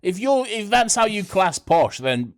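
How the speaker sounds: high, loud, playful challenge; mock outrage. Challenging the co-host over who sounds posh, mock outrage.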